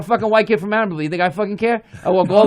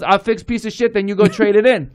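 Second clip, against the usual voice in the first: Chinese accent impression. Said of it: "He goes".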